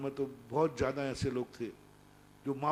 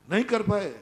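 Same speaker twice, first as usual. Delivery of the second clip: higher and louder, hammering नहीं; loud emphatic; 6 semitones higher, 10 dB louder than usual. Shown as a demonstration. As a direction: repeat it as a forceful rebuke. (ज़ोर देकर ऊँचे स्वर में)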